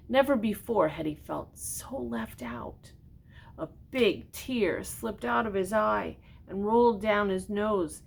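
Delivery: put-on gentle read-aloud to a child; storybook reading. A narrator's reading. Reads picture book aloud.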